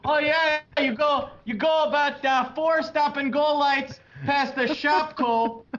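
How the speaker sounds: high, sing-song Wisconsin local voice; Wisconsin accent. Retold or imitated. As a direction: play a chirpy Wisconsin local giving directions. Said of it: "They go".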